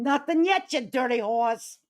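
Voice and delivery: loud riled-up taunting. Acted. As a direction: call them out loudly. Trash talking rival streamers.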